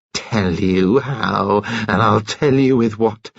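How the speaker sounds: slow, deliberate enraged words; slow menace. Acted. Kitty enraged, threatening the nurse slowly. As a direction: spell it out slowly in rage.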